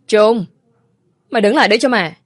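loud, high, furious call after him; angry shout. Acted. Mother yelling at her son to stop, loud angry shout. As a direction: bellow at the son to stop him walking away.

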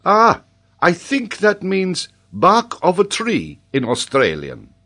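high, loud, drawn-out discovery; excited scholar. A narrator's reading. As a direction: exclaim it slowly in a high voice. Paganel excitedly explains the name.

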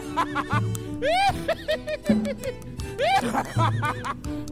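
long, shrieking high laugh; staged high-pitched cackle. Acted. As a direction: peals of performed laughter. Staged laughter after slogan.

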